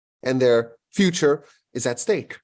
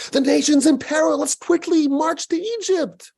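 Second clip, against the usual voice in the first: much higher, urgent call to rush out; rally them to march now; alarmed rallying cry; 13 semitones higher than usual. Retold or imitated. Imagined urgent call to act.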